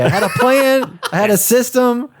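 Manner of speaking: high, whining about the ruined plan; whiny mock complaint. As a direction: play up being thrown off. Complaining plan was derailed.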